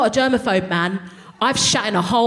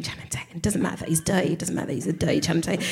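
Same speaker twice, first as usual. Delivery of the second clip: soft, hushed inner voice; whispered, much softer inner thoughts; 4 semitones lower, 8 dB quieter, faster than usual. Retold or imitated. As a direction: murmur to yourself.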